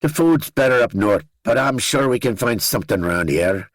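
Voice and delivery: high Northern Irish voice with a smile; Danny, smiling. A narrator's reading. Danny promising the narrator better food up north, smiling Northern Irish accent. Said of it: "he said, smiling".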